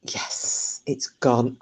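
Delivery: whispered, smug inner voice; cocky thought. Retold or imitated. Hunter thinking the creature has gone, whispered smugness. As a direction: breathe it out as a secret little victory. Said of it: "I'm getting really cocky thinking".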